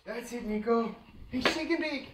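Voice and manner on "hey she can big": high cooing pet talk. Real, not acted. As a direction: coo at the dog. Baby talk to dog.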